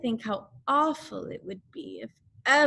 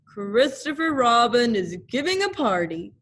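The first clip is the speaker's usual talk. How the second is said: louder owl voice; owl's voice; 2 semitones higher, 5 dB louder than usual. Acted. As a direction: announce it importantly as Owl. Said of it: "he said".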